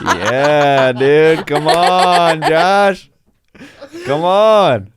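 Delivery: loud mock-urging of Josh; loud teasing. Real, not acted. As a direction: tease him loudly while laughing.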